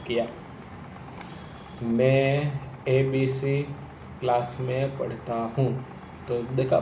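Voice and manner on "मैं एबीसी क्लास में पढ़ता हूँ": मैं ABC drawn out very slowly, the rest normal; slow stretched start. Shown as a demonstration. Coach showing students a slow, stretched opening. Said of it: "वो मैंने थोड़ा सा खींचा".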